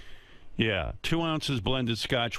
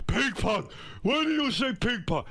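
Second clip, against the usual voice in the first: very high, incredulous yell; outraged dad impression; 13 semitones higher than usual. Father reacting to the name Ping Pong, high outraged yell. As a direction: holler like Dad at his most indignant. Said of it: "He was like".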